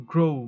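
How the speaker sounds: "grow" as "groh-oo"; correct pronunciation. Shown as a demonstration. Teacher modelling the correct word, "grow" with gliding o-u. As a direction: draw the o of "grow" into oo. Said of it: "সঠিক উচ্চারণ হবে".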